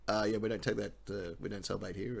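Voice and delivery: low, soft imitation of awkward staff; deadpan staff member. SeaWorld staff refusing a customer, mock-subdued. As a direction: drop low and quiet, politely refusing.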